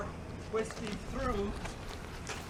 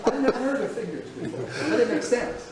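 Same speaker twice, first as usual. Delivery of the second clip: amused laughing; 6 semitones higher than usual. Real, not acted. Guide amused by visitor answer.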